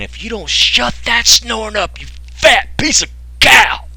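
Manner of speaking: high, slow mock-nagging wife voice. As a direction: drag it out high like a fed-up wife in bed. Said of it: "your wife is over there laying in the bed saying".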